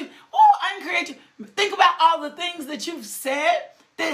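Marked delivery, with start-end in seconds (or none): 0.0-0.6 sudden high preacher's whoop; high ecstatic whoop. Real, not acted. Preacher whooping to the congregation, high joyful cry. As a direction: cry out in joy.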